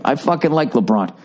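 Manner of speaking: normal talking voice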